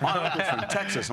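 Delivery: a lightly sent-up online commenter; mocking online critic. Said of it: "a bunch of people online who went".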